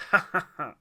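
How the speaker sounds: loud fake ha ha ha; forced laugh. A narrator's reading. Devil faking a laugh along with Yeshua, loud. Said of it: "forced himself to laugh".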